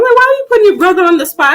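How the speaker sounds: fast, high, teasing scold; imitating a high playful scolding; mock scold. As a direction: playfully tell her off, quick. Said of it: "I'm like".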